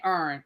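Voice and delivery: "Ern" squeezed into one syllable, low; D.C. pronunciation. Real, not acted. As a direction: say "Ern" for Aaron, D.C. style. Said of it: "that's my D C accent".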